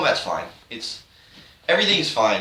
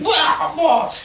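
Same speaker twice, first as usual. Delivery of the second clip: much higher cartoon crying wah; mock baby wail; 4 dB louder than usual. Grant losing to Trevor, much higher mock wailing. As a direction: whimper like a whiny cartoon loser. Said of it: "He's like".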